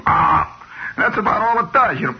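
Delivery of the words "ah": loud open-mouthed toad "ah"; toad croak. Acted. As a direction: blurt it like a giant toad. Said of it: "opens its mouth and goes".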